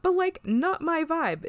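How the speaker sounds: high imitation of Riker turning her down; mock Riker. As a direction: lightly squeak a polite rejection. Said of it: "He's like".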